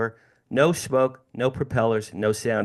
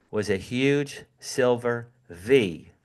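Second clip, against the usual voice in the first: usual speaking voice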